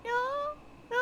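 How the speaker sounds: high echoing syllable, repeated with gaps; imitated reverb. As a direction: mimic an echo bouncing back. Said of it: "does, like, the reverb".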